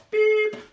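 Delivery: high, loud squeaky beep; trying another funny nose noise, a high beep. Shown as a demonstration. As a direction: beep like a toy horn. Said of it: "it's a funny noise".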